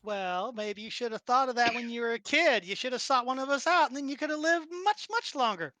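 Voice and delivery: high, scolding character voice; chiding Yuki. Acted. Yuki telling Reggie he should have planned earlier, high chiding voice.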